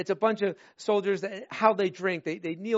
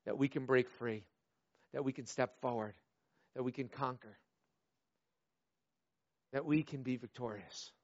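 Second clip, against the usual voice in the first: low, quiet earnest preaching; soft sermon appeal; 5 semitones lower, 8 dB quieter than usual. Acted. Pastor reassuring the congregation, low and quiet. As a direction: speak it softly into their hearts.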